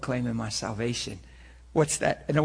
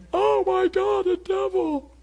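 much higher, louder hammed-up shriek of fright; mock panic; 6 dB louder, slower than usual. Preacher mocking a terrified believer, hammed-up high panic. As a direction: play a believer freaking out at a devil. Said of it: "That sure beats going".

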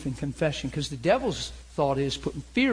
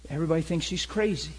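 ordinary delivery

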